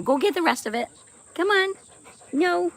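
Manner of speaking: high, bright coaxing to the dog. Real, not acted. Owner coaxing her dog, high and playful. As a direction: coax her like a puppy.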